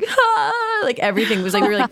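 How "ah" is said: high, overjoyed squeal of delight; excited squeal. Retold or imitated. Guest recalls the lightsaber shop thrill, giddy high squeal. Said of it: "we were just like".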